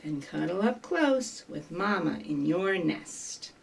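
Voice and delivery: soft, low bedtime tone; tender cuddle. Acted. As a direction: settle them snugly into the nest. Story winds down to cuddling in nest.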